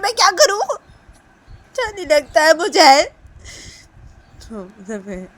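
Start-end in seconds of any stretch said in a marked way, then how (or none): 0.0-3.0 high, loud, drawn-out protest; acted upset complaint. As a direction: whine it loudly, stretching the words. Acted tantrum inside a told story.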